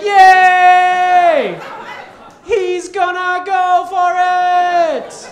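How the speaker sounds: very high, very loud sung cheer; cheering crowd. Retold or imitated. Scene: passengers cheer the pilot, very high loud sung cheer. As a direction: cheer like the team just scored. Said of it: "They're like".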